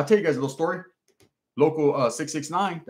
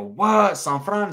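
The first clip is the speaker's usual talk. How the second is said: surprised; 6 semitones higher, 3 dB louder, much slower than usual. Retold or imitated. Realizing who sent the letter.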